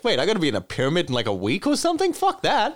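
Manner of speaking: fast, rising to an incredulous high outburst; mock disbelief. A slave learning he'll die in the pyramid, rising outrage. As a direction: rush it, then squeal the refusal. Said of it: "And you're like".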